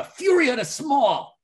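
mock-fierce goblin war shout; hammed-up battle cry. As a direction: announce your big attack with gusto. Player declares special attack in character.

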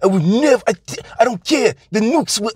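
put-on high, foreign-accented terrorist sneer; a hammed-up foreign accent spitting at an American; mock foreign villain accent, pitched high; vague foreign villain accent. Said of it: "don't ask me where this, um, accent is from".

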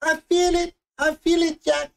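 hammed-up Cajun Rose, breathless with wonder; mock Cajun Rose on the bow of the ship; Cajun Rose impression. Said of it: "And she has her arms like".

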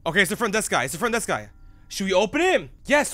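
ordinary talk

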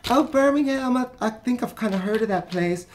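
mock high voice of a lady faking recognition; imitating Florida lady. Vlogger retelling the bus lady who pretends she knows Birmingham, mock high lady voice. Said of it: "she was like".

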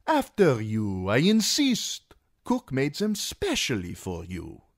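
a fat magister fussing over his guest; voice of Illyrio. A narrator's reading. Fat magister pressing food on his dwarf guest, indulgent. Illyrio urging Tyrion to eat.

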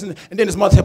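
normal talking voice

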